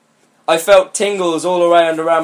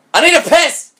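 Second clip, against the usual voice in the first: higher, louder jokey blurt; mock crude blurt; 11 semitones higher, 4 dB louder than usual. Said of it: "crude paraphrase of the quote".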